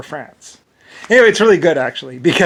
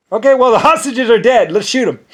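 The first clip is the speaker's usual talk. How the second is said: higher, louder mock dismissive shrug; mock callous; 7 semitones higher, 7 dB louder than usual. On the dead hostages, mock callous shrug. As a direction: brush the dead off coldly.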